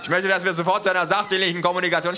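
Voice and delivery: high, fast, strained demand; exasperated. Acted. Official demanding proper talk, high and rushed.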